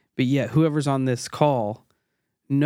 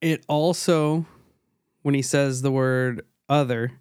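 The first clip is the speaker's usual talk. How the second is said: normal talking voice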